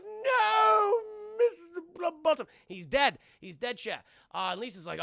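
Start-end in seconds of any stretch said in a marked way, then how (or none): 0.0-2.5 high, loud mock crying; hammed-up wail. Mrs Plumbottom mock-wailing over her husband, high and loud. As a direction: wail it like a melodrama widow.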